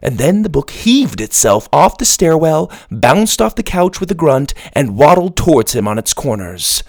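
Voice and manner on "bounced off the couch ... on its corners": usual speaking voice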